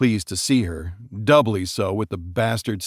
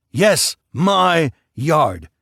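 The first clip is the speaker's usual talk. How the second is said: very slow, louder, each word pressed; defiant insistence; 6 semitones higher, 5 dB louder, much slower than usual. Acted. Husband insisting to his wife, slow and hard. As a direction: lay it down word by word.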